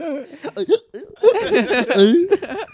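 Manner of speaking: high-pitched giggle fit; helpless giggling; high squealing laugh. Real, not acted. Hiccup fit.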